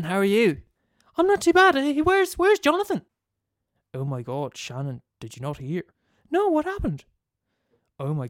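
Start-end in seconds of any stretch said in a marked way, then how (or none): 1.2-3.0 put-on much higher chatty young woman; high girly voice. Shannon character voice. 6.3-7.0 put-on much higher chatty young woman; high girly voice. Shannon character voice.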